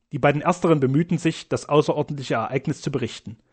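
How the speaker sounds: usual speaking voice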